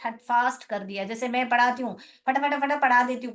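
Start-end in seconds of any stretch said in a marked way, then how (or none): 2.3-3.3 fast. Shown as a demonstration. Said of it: "मेरा speed का मुझे ही एहसास नहीं होता".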